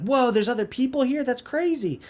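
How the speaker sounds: high-pitched imitated astonishment; mock amazement. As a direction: act wowed by a discovery. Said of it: "and they're like".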